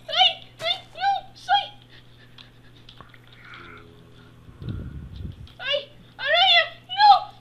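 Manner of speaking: high, loud panicked shrieks; terrified screaming. Acted. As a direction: scream for your life. Attacked by zombie and spider.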